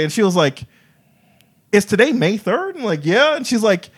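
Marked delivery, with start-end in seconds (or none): 1.7-3.9 high, building amazement; mock shock at sharing a birthday; excited disbelief; rising excitement. Birthday coincidence revealed.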